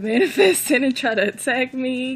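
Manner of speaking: loud, laughing words with pitch jumping up; amused outburst, voice lifted by laughter; laughing while talking. Real, not acted. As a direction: laugh through the whole phrase. Laughs at feared possessed attack.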